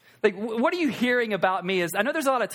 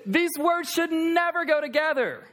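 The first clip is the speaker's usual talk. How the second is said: higher and slow, leaning on "never"; emphatic; 7 semitones higher than usual. Real, not acted. Preacher stressing which words never belong together. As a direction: stretch out "these words should never go together". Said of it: "two words that don't go together".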